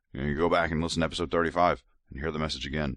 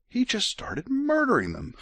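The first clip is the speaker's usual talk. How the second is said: much higher and slow, stressing murdering; shocked emphasis; slower than usual. Real, not acted. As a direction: drag out murdering in outraged disbelief. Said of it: "retelling Alma's attack".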